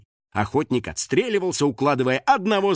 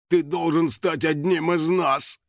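slow, higher, mildly tearful plea; through tears; 4 semitones higher, slower than usual. A narrator's reading. Biker welcomes Финик into the gang, choked up. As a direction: draw it out with a touch of tears. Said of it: "сквозь слёзы выдавил Байкер".

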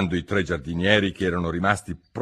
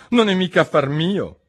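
higher and louder, defiant; bold retort; 10 semitones higher, 5 dB louder than usual. Acted. Alice risponde alla regina, audace. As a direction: answer back without fear.

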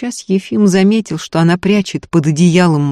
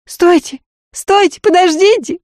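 very high-pitched woman's cry; urgent calling; 14 semitones higher, 4 dB louder than usual. A narrator's reading. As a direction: call after people walking away. Young woman runs after them, calling.

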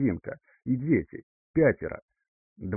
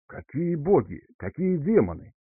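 higher-pitched startled questions; goggle-eyed astonishment; 6 semitones higher than usual. A narrator's reading. Father hears of gods and demons, astonished. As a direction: ask both questions in mild disbelief. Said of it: "выпучив глаза".

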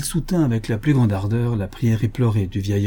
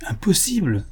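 astonished exclamation; 7 semitones higher than usual. A narrator's reading. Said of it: "s'écria Buffalo Bill".

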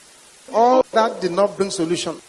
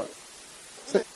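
quiet; 15 dB quieter than usual. Real, not acted. Whispered aside in story.

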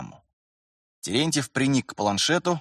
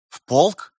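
high, louder surprised question; 6 semitones higher, 6 dB louder than usual. A narrator's reading. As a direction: echo it back in surprise. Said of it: "Рихард удивлённо поднял брови". (удивлённый переспрос)

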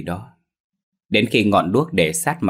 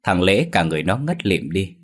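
plain reading voice

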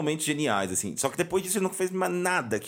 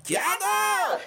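teacher calling out. Retold or imitated. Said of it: "Aí a professora".

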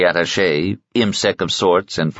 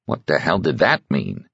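plain reading voice

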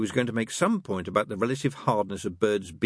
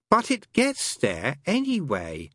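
persevering; 9 semitones higher, 3 dB louder, slower than usual. Acted. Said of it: "Crowley persevered".

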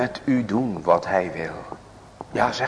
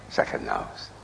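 whispered plea; 11 semitones lower, 9 dB quieter, faster than usual. Acted. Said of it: "intimate plea".

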